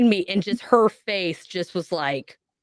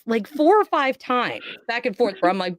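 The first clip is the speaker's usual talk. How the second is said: normal talking voice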